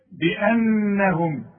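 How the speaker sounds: بأنهم very slow, the ن held nasal; slow nasal recitation of بأنهم; nasal ghunna. Shown as a demonstration. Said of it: "اوعى تنسى الغنة".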